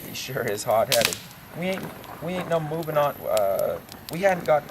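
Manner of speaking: ordinary talk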